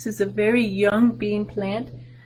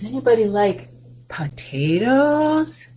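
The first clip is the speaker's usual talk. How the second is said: drawn-out question; 3 semitones higher, slower than usual. Shown as a demonstration. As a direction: stretch the word to tease.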